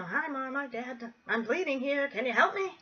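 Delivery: high, light sing-song mock call home; pretend phone call. Child casually phoning mom and dad for help, playful mock plea. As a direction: put on a casual, high-pitched plea to the parents. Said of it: "wasn't like that".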